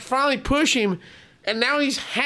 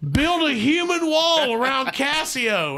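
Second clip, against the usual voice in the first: high, drawn-out call; slow, urgent command pitched up; owner's alarmed order; 7 semitones higher, slower than usual. Retold or imitated. Owner rallying men against wild monkey, high slow call.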